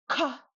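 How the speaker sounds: "ka" high, with a big puff of air; forceful aspirated high "ka"; super hard K. Shown as a demonstration. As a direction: blast the K of "ka". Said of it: "super hard".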